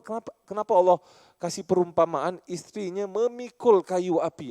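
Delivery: ordinary talk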